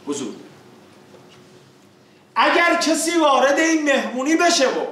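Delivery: usual speaking voice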